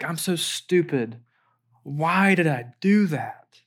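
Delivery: slow, heavy self-reproach; ashamed self-talk. Acted. Someone ashamed of their past blaming themselves, ashamed self-talk. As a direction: berate yourself slowly, dwelling on each word. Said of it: "shame about our past will sound like".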